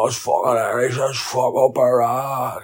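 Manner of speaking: high, slow mock-drunk gushing; mocking revellers. Revellers gushing about an Irish song, mocked high and slow. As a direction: send up the clueless crowd. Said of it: "all sitting there going".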